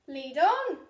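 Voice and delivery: loud eager cry; cried out. Acted. The rat urging the duck along, loud cry. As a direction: call it out loud and greedy. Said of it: "cried the rat".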